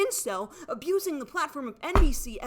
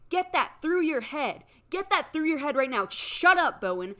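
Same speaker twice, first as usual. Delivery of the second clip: louder, rising fury, hammering each word; angry shouting; 3 semitones higher, 5 dB louder than usual. Real, not acted. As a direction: shout it at him, demanding he listen. Angry rant at Bowen.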